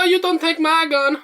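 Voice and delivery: very high, slow falsetto big mama; hammed-up Southern mama with a gun, up in falsetto; slow, sky-high mock church mother. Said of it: "Big Mama".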